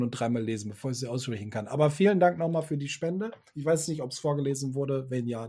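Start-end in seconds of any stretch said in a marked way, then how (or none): none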